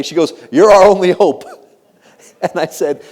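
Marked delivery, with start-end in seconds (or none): 0.5-1.4 loud, higher, hammed-up appeal; retold dramatic plea. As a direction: pile on the pressure at full volume. Retold plea pinning hopes on speaker.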